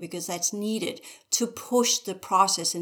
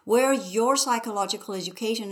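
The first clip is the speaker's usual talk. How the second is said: normal talking voice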